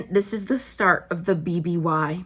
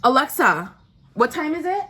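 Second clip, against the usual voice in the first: louder and higher, insisting to the device; louder repeat; 7 semitones higher, 4 dB louder than usual. Real, not acted. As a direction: call it out again so Alexa hears. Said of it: "repeats the question to Alexa".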